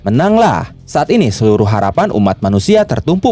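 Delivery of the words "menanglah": higher-pitched cheer, only lightly shouted; mild narrated shout. A narrator's reading. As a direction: cheer them on without a full yell. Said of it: "Vanessa, dan Grey berteriak". (sorakan ringan bernada tinggi)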